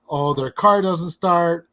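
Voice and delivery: ordinary talk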